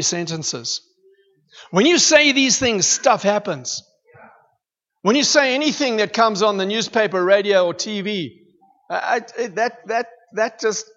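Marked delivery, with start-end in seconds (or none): 1.7-6.7 loud, higher-pitched preaching build; preaching at full force. Acted. Preacher exhorts the church about the word's power, fervently. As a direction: drive the point home to the congregation.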